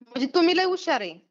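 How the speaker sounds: ordinary talk, nothing marked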